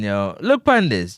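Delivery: usual speaking voice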